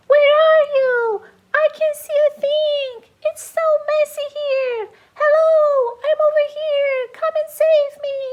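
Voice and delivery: high, pleading calls for help; lost pets beg Jesse to save them; lost pets calling. Acted. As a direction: cry out from inside the mess.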